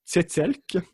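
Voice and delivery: French-accented attempt at the word tzetzelk; careful try at tzetzelk, sounding a bit French. Real, not acted. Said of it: "that was a French accent".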